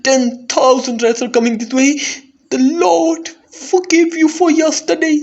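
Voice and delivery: high, loud frantic cry; panicked warning. Acted. Servant urging the bishop to flee, frantic and loud. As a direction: shout fly as if the rats are behind you. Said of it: "he was pale as pale could be".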